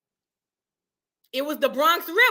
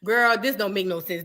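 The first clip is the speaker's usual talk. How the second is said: normal talking voice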